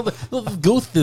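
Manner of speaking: high, lisping boxer impression; Mike Tyson accent. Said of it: "that whole Mike Tyson accent".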